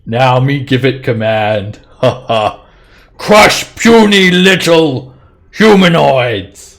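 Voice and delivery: slow, loud, high brute giant; dopey cartoon bellow. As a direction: bellow the command like a dim giant. Giant commands golem to attack.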